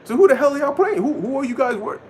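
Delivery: God calling out the worshippers; mock outraged shout; indignant God. Lord voiced, demanding answer.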